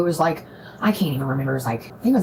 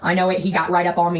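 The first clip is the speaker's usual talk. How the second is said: ordinary talk, nothing marked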